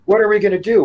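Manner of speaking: high, loud imitated panic; hammed-up frightened question; freaked out. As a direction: mimic a panicked camper. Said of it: "So she's all freaked out".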